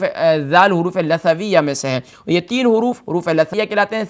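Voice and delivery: ordinary delivery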